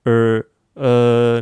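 "うー" as a long muffled er, no r; level, sustained British-style vowel. Shown as a demonstration. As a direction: hold "うー" with mouth barely open. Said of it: "イギリスっぽい音".

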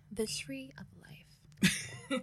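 slow, hushed mysterious whisper; dramatic whisper. Pretend. Host announcing the next theory topic, whispered dramatically. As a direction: breathe the title like a secret.

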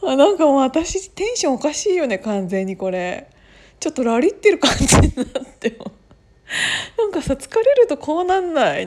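giddy, high and loud tired rambling; stoned. Real, not acted.

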